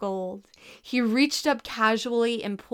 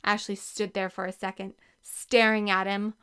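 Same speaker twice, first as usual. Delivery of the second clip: ordinary delivery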